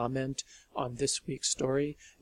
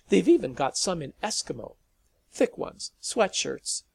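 nervous eager rambling; 5 semitones higher, 7 dB louder than usual. Acted.

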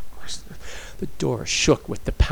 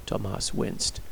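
ordinary delivery, nothing marked

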